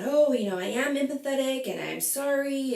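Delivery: lightly voiced sarcastic Rachel; mocking quote. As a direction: parody her fake empathy. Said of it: "she's talking about".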